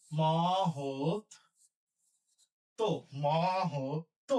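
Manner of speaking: মহত্ব drawn out slowly as "mohotto"; slow dictation. Shown as a demonstration. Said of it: "হয় ওকার".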